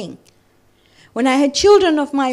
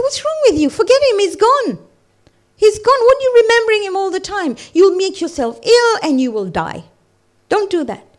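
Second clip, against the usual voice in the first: much higher, louder angry outburst; imitating shouting sons; 6 semitones higher, 4 dB louder than usual. Sons shouting at their grieving father, higher and louder. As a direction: throw it back at him like guilty sons. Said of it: "they shouted".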